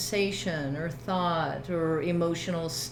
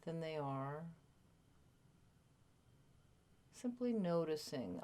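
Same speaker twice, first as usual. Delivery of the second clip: guided meditation; 2 semitones lower, 12 dB quieter than usual. Real, not acted. Teacher settling a group into body awareness, guided meditation. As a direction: gently lead listeners into stillness, leaving space between phrases.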